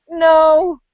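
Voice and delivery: hammed-up, high-pitched refusal; mock emphatic refusal. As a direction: imitate kids turning down an offer of help. Acting out the kids' refusal.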